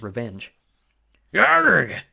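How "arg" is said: loud high pirate roar; pirate yell. A narrator's reading. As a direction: roar arg from behind. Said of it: "Blackbeard yelled".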